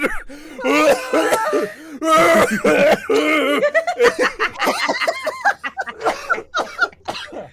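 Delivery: loud high-pitched laughter; long high laugh. Real, not acted. Celebrating the victory.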